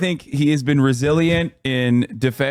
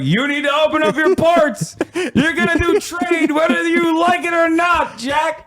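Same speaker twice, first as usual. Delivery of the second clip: bully them like a gunboat captain; mock commodore shout; 11 semitones higher than usual. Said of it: "was like".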